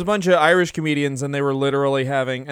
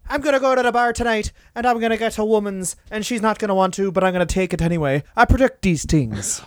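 fast, high Irish brag; mocking McGregor; 7 semitones higher, faster than usual. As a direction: spit out a cocky Irish boast. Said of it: "Mystic Mac foretells".

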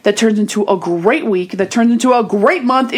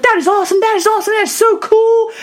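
gushing commenters; 9 semitones higher than usual. Retold or imitated. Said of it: "people are all over her Facebook photo like".

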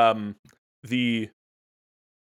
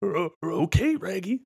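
hesitant cartoon talking dog, pitched up; Scooby-Doo; 6 semitones higher than usual. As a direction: answer as a dog, R sounds leading the words. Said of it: "Shaggy and Scooby".

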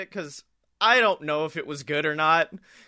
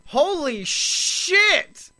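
drawn-out, much higher, louder exclamation; real surprise; 12 semitones higher, 5 dB louder, much slower than usual. Real, not acted. Host stunned by a photo in the chat, slow loud exclamation. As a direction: stretch it out in stunned disbelief.

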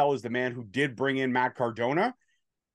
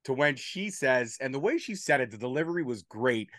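ordinary talk, nothing marked

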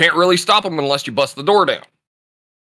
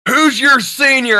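barked drill sergeant question; 12 semitones higher, 5 dB louder than usual. Retold or imitated. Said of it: "he goes".

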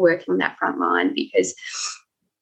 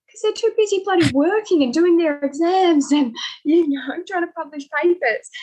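mock exasperation; 9 semitones higher than usual. Answering why doctors don't do this.

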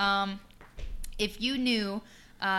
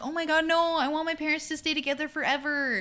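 put-on upset little girl; 8 semitones higher than usual. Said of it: "the end of the world for little Sarah".

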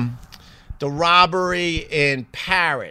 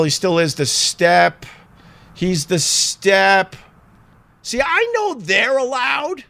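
ordinary talk, nothing marked

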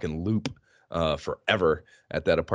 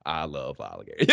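Southern accent; 6 semitones lower, 5 dB quieter than usual. Shown as a demonstration. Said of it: "And I go".

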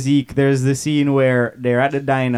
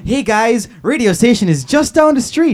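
eager bartender; 7 semitones higher, 4 dB louder than usual. Retold or imitated. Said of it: "He's just like".

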